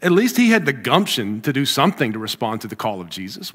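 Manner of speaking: ordinary delivery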